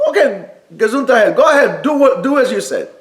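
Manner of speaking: laughing agreement. Retold or imitated. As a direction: voice the angels giving in, chuckling. Angels cheerfully agreeing to Avraham.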